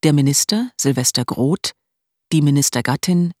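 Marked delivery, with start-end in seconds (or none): none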